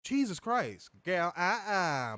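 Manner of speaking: drawn-out mock sassy refusal; slow, lightly mocking nineties slang impression; lightly put-on sassy dismissal.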